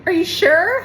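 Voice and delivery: incredulous salesman. Retold or imitated. Salesman doubts her refusal.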